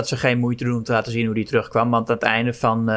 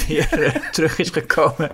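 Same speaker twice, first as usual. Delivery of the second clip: laughter breaking into the words; amused, words broken up by chuckles; laughing while talking; 2 semitones higher than usual. Real, not acted. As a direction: try to finish the sentence through a real laugh. Amused by absurd film plot.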